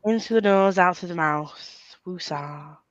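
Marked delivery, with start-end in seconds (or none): 2.1-2.8 calming mantra. Pretend. Relaxation mantra.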